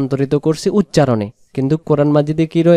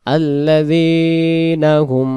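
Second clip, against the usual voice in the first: very slow stretched tajweed chant; slow melodic verse recitation; Quran recitation; 3 semitones higher, much slower than usual. Shown as a demonstration. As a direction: draw out the nasal ending melodically. Recites the phrase.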